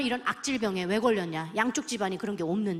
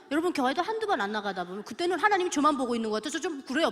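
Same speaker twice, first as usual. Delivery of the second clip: ordinary delivery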